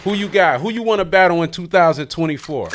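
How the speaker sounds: loud, high hype questions; hype call-out. Real, not acted. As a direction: pump her up to call names. Hyping her to call out.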